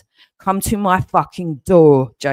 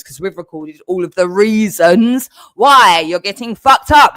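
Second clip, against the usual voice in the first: louder and higher into a yell; building to a yell; 4 semitones higher, 5 dB louder than usual. Real, not acted. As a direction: climb into a full furious yell on fucked up. Threatening, rising to a yell.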